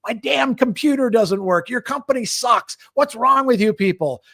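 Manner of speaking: hammed-up rant like a fed-up customer; mock angry customer. A customer venting at a service worker in a mock loud, high rant.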